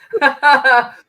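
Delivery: loud, high cackle; burst of laughter. Real, not acted. Amused at her channelled message.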